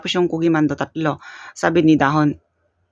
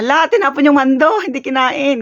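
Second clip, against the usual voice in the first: raised voice, higher, telling on grandma; announcing to all; 7 semitones higher, 3 dB louder than usual. Retold or imitated. Telling everyone grandma threw away the mando, loud announcement. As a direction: call it out to the whole household. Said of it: "in-announce ko sa lahat".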